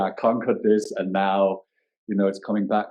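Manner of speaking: ordinary talk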